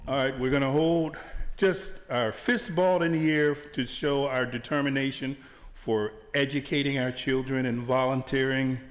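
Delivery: ordinary talk, nothing marked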